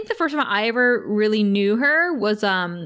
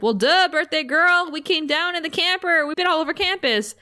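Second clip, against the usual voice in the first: high-pitched mock "well, duh"; imitated brash brother; 8 semitones higher than usual. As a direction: play the obnoxious big brother, pitched up. Acting out Tara's brash brother.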